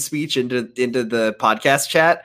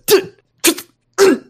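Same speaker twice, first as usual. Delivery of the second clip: high mock tut tut; disapproving tutting; 5 dB louder than usual. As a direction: tut it like a prim scold. Reading a comment aloud, tutting.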